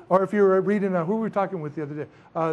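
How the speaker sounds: usual speaking voice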